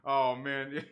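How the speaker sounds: ordinary talk, nothing marked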